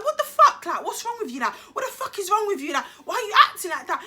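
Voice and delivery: high-pitched, rapid-fire mock demand; exasperated retold questioning; imitated bewildered boyfriend. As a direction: act out his baffled outburst, speeding up. Boyfriend's alarmed reaction acted out.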